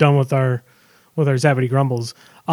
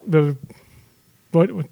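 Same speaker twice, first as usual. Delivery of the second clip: ordinary talk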